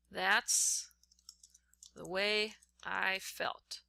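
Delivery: slow, word-by-word dictation with long pauses. Shown as a demonstration. Teacher reads the quote aloud to the class while typing it, slow and halting. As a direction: spell it out as you type each word.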